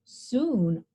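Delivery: ordinary delivery, nothing marked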